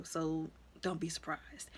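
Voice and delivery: hushed warning. Retold or imitated. Sister warning friend about father.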